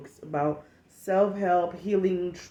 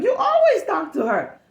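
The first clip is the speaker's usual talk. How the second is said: mocking coworker; 12 semitones higher, 6 dB louder than usual.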